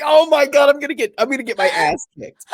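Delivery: high, loud mock-panicked yelp; retold panic. Caught faking an accent, retold in comic panic. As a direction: play up the dread of being found out. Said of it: "And I was like".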